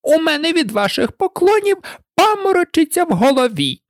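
high-pitched royal complaint; shrill, exasperated queen; screeching queen. A narrator's reading. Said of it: "верещала королева".

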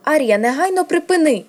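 high, loud, alarmed command; scared sister crying out to stop her; frightened. A narrator's reading. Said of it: "Санса злякалася".

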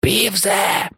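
rasping impersonation of a ghost; hoarse hiss. A narrator's reading. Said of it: "засичав він хрипко".

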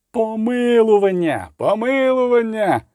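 high, long-drawn crowd cry; crowd yelling. A narrator's reading. Crowd hollering for the pardon, high drawn-out yell. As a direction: stretch each cry out high. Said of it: "репетував натовп".

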